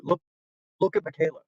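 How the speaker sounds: normal talking voice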